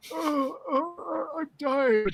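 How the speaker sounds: high, slow hammed-up wailing; mock crying. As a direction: wail like a cartoon victim. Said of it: "He's like".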